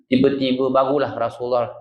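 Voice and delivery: ordinary talk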